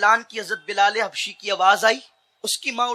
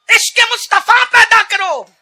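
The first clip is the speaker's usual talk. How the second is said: high, loud fiery sermon; preaching at full force; 11 semitones higher, 7 dB louder than usual. Acted. Preacher urging parents, roaring high and loud. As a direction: build the crowd with a roar.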